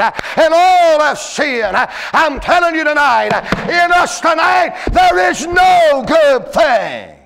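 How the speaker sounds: slow, loud preacher's shout, drawn out; full-force preaching. Acted. Preacher thundering scripture at the congregation, slow and loud.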